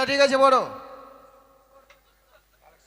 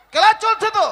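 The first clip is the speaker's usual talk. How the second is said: higher, emphatic repeat; insistent reminder; 6 semitones higher, 4 dB louder than usual. Acted. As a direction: insist to the pupil that class is on. Teacher insists class is on.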